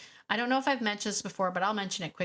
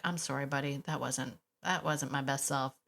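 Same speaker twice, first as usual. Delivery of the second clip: low, soft apology to a dog; acted quiet, contrite murmur; apologetic; 5 semitones lower, 4 dB quieter than usual. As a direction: gently own up, voice dropped.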